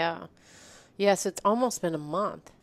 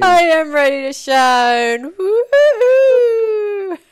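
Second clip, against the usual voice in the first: loud, high, slow and stretched; excited cheer; 11 semitones higher, 9 dB louder, much slower than usual. Real, not acted. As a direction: shout it out with full enthusiasm, stretching the woo-hoo. Answering host's "are you ready to shine.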